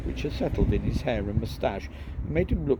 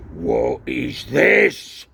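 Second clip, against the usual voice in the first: slow, louder angry growl; 7 dB louder, much slower than usual. Acted. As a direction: drag the words out, menacing. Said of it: "growled Uncle Vernon".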